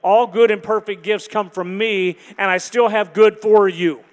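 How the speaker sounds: loud, emphatic promise; voice of the Spirit. Acted. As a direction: proclaim it with firm, booming assurance. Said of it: "The voice of the Spirit will say".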